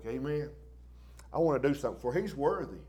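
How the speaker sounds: ordinary talk, nothing marked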